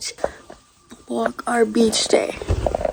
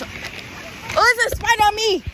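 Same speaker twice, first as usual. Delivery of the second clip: startled; 9 semitones higher than usual. Real, not acted. Spider found on her.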